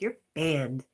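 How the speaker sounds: hammed-up moderator banning someone forever; mock ban decree. Teasing a viewer with a mock loud ban decree. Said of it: "I was like".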